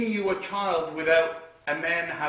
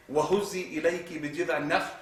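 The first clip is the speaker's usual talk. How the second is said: rapid chanted Arabic recitation; Quranic recitation; much faster than usual. Acted. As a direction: recite the verse quickly.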